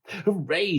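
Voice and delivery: high, triumphant cheer; excited shout. A narrator's reading. George hails the monster he takes for the Yeti, excited shout. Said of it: "shouted George".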